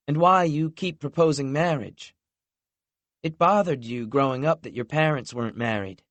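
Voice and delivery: gently voiced girlfriend owning her mistake; voiced young woman, understanding. A narrator's reading.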